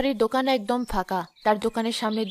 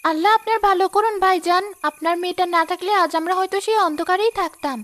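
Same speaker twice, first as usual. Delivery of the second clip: higher and louder, heartfelt thanks; grateful blessing; 8 semitones higher, 4 dB louder than usual. Acted. Poor woman thanks Chowdhury.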